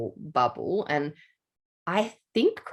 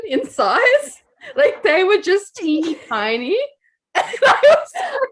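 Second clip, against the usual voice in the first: breaking into high laughter, louder; laughing; 13 semitones higher, 7 dB louder than usual. Real, not acted. Telling co-host about the tiny mice, laughing hard. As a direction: laugh through it, barely holding on.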